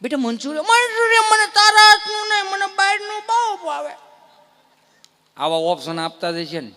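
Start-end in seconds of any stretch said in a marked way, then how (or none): 0.0-0.6 higher, sugary pampering mom; doting mother. Retold or imitated. Mother offering. 0.6-3.9 put-on whiny spoiled kid. Child refusing.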